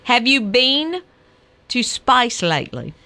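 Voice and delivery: slow, deliberate Australian accent. Shown as a demonstration. Host demonstrating for the engineer, slow Australian accent. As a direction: model it slowly in Australian. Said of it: "I wanna hear you say it".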